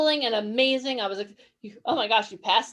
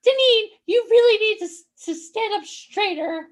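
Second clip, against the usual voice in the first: nagging grandma; 9 semitones higher, 4 dB louder than usual. Retold or imitated. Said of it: "My nanny used to love to tell me that".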